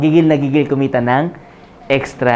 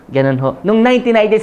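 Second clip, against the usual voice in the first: normal talking voice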